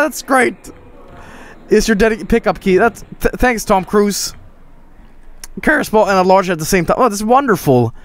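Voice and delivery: normal talking voice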